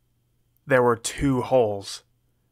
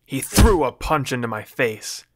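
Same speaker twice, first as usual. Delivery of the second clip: plain reading voice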